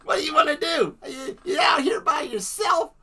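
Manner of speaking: high, loud, fully voiced man calling to a kid; man talking to a child. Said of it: "Fully voiced and animated".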